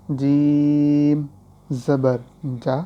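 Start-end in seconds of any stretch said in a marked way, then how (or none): 0.1-1.2 جیم held long and loud; drawn-out letter name. Shown as a demonstration. Teacher spells for students, long drawn جیم. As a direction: stretch جیم out fully, as when spelling. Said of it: "تو ہجے کر لیں".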